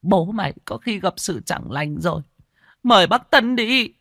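weep as you beg him to go; sobbing. Acted. Weeping wife fears her husband is dead, sobbing high. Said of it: "mụ òa khóc nức nở".

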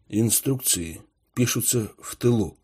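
quiet, even reply; calm objection. A narrator's reading. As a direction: answer calmly, unbothered. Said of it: "спокойно возразил Богданов".

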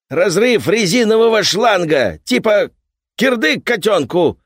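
loud, high shouting workman. Acted. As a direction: raise your voice like an angry repairman. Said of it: "повысил голос дядька".

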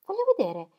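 high, eager interjection; curious. A narrator's reading. Celia wants a look at the stranger, eagerly curious. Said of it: "con rinnovellata curiosità".

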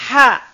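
ha breathed loud from the middle of the throat. Shown as a demonstration. Teacher showing the middle throat letter, loud breathy demo. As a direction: push ha from mid-throat.

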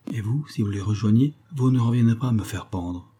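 usual speaking voice

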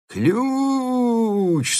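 high, far wailing yell. Acted. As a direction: howl it like a madman. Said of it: "снова завопил Пельт".

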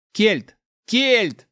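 loud, high-pitched urgent shout; desperate cry. A narrator's reading. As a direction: cry out the name in alarm. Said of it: "раздался крик Эмиля". (отчаянный крик)

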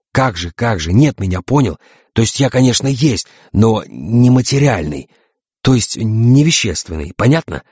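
testy, spelling it out; irritated explaining. Acted. Invisible captain explains he is immaterial, irritated.

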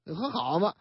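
ordinary delivery

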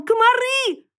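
very high squeal for rescue; high-pitched alarm cry; piglet wailing. A narrator's reading. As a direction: squeal it high, as if fleeing in panic. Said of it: "вопил он".

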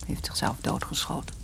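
whispered, voice nearly gone; barely audible grieving whisper; hushed whisper. Acted. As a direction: breathe the words out in pain. Widow tells of husband's suicide.

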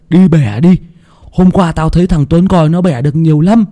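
put-on high, loud excited boyish voice; eager boy. Hưng urging Tèo to steal longans, high excited boyish voice. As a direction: rope your friend into stealing longans.

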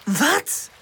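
high, outraged cry; indignant exclamation. A narrator's reading. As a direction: exclaim in disbelief at the insult. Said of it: "roept Pip uit".

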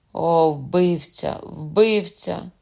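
bitter outcry. A narrator's reading. Wounded Lamole cries out against his murderer. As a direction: drag out the word in hatred.

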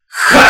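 loud short roar; battle roar. A narrator's reading. Undead soldiers answer their marshal with a loud roar. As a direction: bellow a war cry. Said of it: "хором взревела дивизия".

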